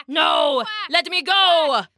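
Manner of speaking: high protest rising into a cry; panicked king. A narrator's reading. King grabbed by the crowd, panicked. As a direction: cry out as a captured tyrant.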